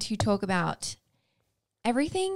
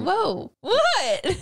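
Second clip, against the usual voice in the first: surprised; 10 semitones higher, 5 dB louder than usual. Real, not acted. Sudden startled reaction.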